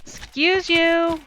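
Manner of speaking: high, loud, drawn-out objection; indignant exclamation. Real, not acted. Streamer telling off a noisy game creature, indignant. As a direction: call out in slow, playful indignation.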